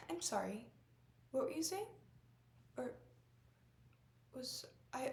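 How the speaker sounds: sigh, then a rising confused question; losing track mid-conversation; lost thread. Acted. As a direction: apologise softly, then lift into puzzlement. Acted lapse of memory.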